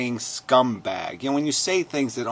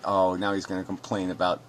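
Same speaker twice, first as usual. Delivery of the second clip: normal talking voice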